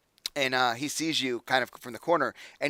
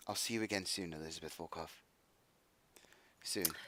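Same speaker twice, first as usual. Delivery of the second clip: sad; 6 semitones lower, 14 dB quieter than usual. Acted. Sad farewell, very quiet.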